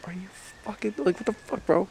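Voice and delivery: squeaky high mock disbelief; mock incredulous self. Dad reacting to his son's plan, squeaky mock disbelief. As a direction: throw up your hands in high-pitched disbelief. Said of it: "And I'm like".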